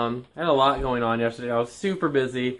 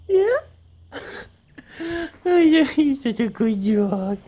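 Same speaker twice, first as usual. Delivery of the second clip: talking to a dog; slower than usual. Real, not acted. Cooing over her dogs.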